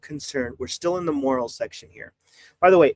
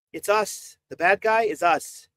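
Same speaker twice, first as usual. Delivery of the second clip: ordinary talk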